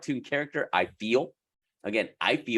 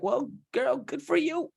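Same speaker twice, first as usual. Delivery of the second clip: high, sarcastic sass; sassy high voice; 13 semitones higher than usual. Acted. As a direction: brush it off with sass. Mocking a lucky client.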